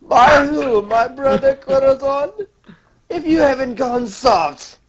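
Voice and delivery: high-pitched mocking villain; hammed-up villain sneer; Doflamingo. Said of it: "Doflamingo's just laughing like".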